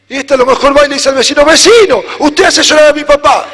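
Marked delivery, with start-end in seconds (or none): 1.4-3.5 put-on higher, louder childish reproach; a kid blaming the neighbor; child scolding. Child tells neighbor he makes dad cry, mock childish reproach.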